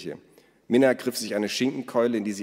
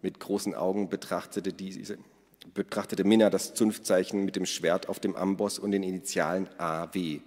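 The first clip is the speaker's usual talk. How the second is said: ordinary delivery, nothing marked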